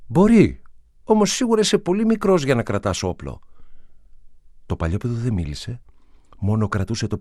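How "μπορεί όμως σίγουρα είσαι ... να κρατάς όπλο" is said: put-on higher, louder voice for the young man; lightly voiced gentle young man; man's voice. A narrator's reading. Young man gently tells the boy he is too small.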